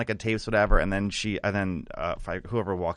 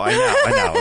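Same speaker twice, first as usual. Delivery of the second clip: very high, loud i know; excited squeal; 9 dB louder, faster than usual. Real, not acted. Teller squealing agreement at juicy gossip.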